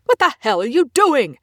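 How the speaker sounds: loud, high, angry bark; angry shout. Acted. Soldier catching the scientist mid-fight, angry shout. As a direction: bark his name in alarm. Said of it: "Greer snaps angrily".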